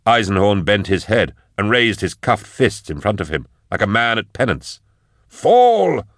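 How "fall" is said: high, loud drawn-out command; Magos's command. Acted. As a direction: cry it out as an imperious Magos. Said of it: "said the Magos".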